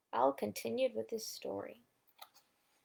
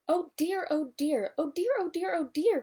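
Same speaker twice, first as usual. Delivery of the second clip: high, louder panicky oh dears; frightened cry; 8 semitones higher, 4 dB louder than usual. A narrator's reading. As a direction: fret high and fast in alarm. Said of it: "cried Piglet".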